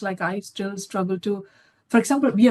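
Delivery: ordinary talk, nothing marked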